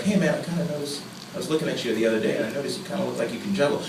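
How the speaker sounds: low, soft, quick offhand remark; casual friend. Retold or imitated. Friend casually bringing up something he noticed, offhand. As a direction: mention it in passing, fast and low. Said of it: "that's not like if a friend".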